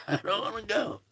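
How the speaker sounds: high, soft, playful mock whine. Host teases viewers he won't leave, in a high mock whine. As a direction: pout it like a kid who won't leave.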